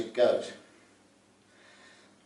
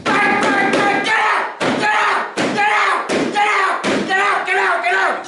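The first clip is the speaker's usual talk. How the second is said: much higher, much louder, frantic repeated yells; instructor screaming; 10 dB louder, much slower than usual. Retold or imitated. Instructor yelling at the student in the simulator, frantic screaming. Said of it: "He shouts in your face".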